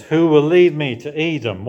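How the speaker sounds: David's plea. Retold or imitated. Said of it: "And so he says".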